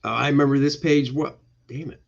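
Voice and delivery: ordinary delivery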